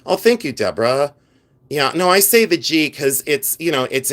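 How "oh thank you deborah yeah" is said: ordinary talk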